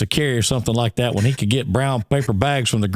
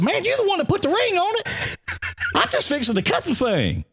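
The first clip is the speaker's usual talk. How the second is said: retold retort. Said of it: "I said".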